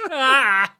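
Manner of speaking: high, loud happy yelp; dog in a human body, excited yelp. Pretend. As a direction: let out a giddy dog yelp.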